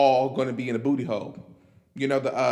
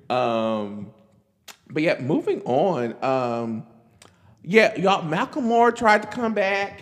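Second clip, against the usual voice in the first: usual speaking voice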